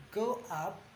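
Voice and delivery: normal talking voice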